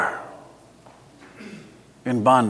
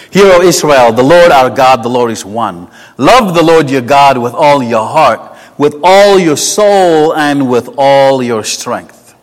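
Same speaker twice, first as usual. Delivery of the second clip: recited Shema; 5 dB louder than usual. Acted. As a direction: proclaim the Shema like a creed.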